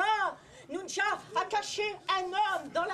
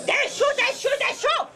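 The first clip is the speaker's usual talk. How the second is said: shouted exclamation; 5 semitones higher, 7 dB louder than usual. Acted. Ogress mother shouting in tale.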